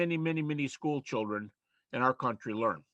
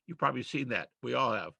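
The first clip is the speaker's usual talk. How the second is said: ordinary talk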